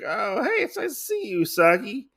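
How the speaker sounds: mock coy high voice; sweet Kitsune greeting.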